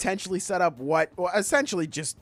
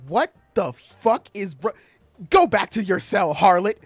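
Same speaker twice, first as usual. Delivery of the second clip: loud, indignant scolding; mock outrage; 3 semitones higher, 6 dB louder than usual. Villain sending the captive back to her cell, hammed-up indignation. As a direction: play an affronted villain ordering a captive off.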